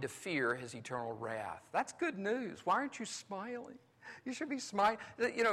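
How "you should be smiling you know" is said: ordinary talk, nothing marked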